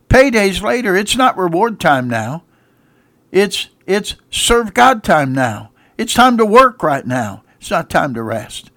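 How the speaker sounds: loud, high call-and-response preaching; preaching at full force. Acted. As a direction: drive it home to the congregation.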